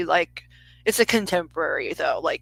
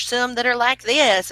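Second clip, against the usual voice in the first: higher, louder put-on Southern accent; Southern twang; 5 semitones higher, 6 dB louder than usual. Shown as a demonstration. Showing how other Southerners talk, higher Southern twang. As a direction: exaggerate a high Southern twang. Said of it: "and it's not like that".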